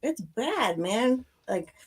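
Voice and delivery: ordinary talk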